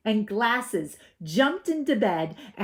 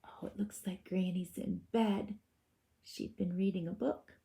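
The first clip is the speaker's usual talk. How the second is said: 1 soft aside; 8 dB quieter than usual. Real, not acted. Aside to children while reading.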